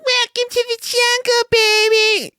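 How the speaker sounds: very high strained falsetto screech; meme woman screeching. Acted. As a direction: squeal it like a hair-metal wannabe. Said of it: "this really out of shape woman in leopard print".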